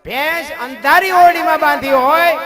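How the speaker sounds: high, loud call to think; urgent loud exhortation. Real, not acted. Preacher loudly urging the crowd to think. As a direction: press the audience hard.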